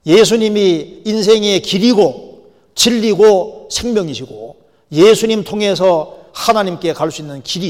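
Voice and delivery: loud, drawn-out Bible declamation; forceful scripture preaching. Real, not acted. Preacher quoting Jesus's words to listeners, forceful scripture preaching. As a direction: proclaim the verse with weight, slowly.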